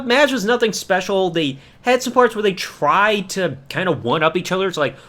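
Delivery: normal talking voice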